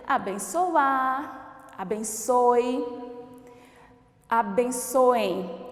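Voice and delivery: abençoar, abençoe, abençoem each drawn out slowly; teacher dictating verb forms slowly; slow demo words. Shown as a demonstration. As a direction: model each form slowly, ending clearly on E. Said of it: "o verbo terminado em oar e finaliza com a letra E".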